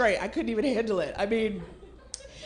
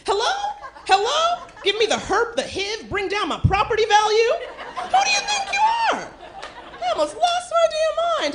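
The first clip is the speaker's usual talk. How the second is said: much higher, shrill-free indignant rant, laughing; outraged squeal; 11 semitones higher than usual. Acted. Scolding the man who refused a condom, high outrage.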